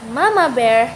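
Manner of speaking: ordinary delivery, nothing marked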